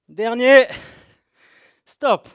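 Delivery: loud high coaching calls; shouted cues. Real, not acted. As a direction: push them through the last rep. Coach calling last rep, stop.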